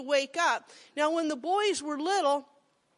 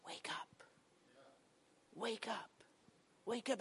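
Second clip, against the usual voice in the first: soft whisper, much quieter; whispered wake up; 3 semitones lower, 13 dB quieter than usual. Retold or imitated. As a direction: gently whisper them awake. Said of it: "I want him to say".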